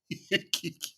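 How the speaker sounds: soft, voiceless snickering; stifled laughter. Real, not acted. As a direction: snicker without voice. Laughs after replaying clip.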